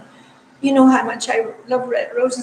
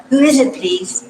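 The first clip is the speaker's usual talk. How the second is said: louder, higher call through the door; retold yell; 4 semitones higher, 7 dB louder than usual. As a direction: call out to an unseen visitor, mildly yelling. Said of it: "and then I yelled".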